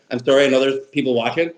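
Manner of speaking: normal talking voice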